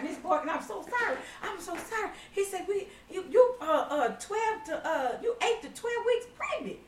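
high voice, laughing through it; mock the stammering doctor; laughing doctor imitation.

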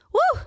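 a high whooping breath to hold back tears; tearful woo. Real, not acted. Host tearing up over her dark months, high woo. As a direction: blow out a woo to stop yourself crying. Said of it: "I'm, like, crying".

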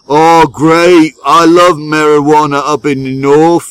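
loud, high mock Northern English accent. Friend from the north hearing of a dealer, hammed-up Northern English accent. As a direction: belt it out as an eager northern English mate. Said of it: "Andy's like".

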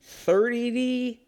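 high, slurred reading. Real, not acted. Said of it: "I sound like I'm drunk".

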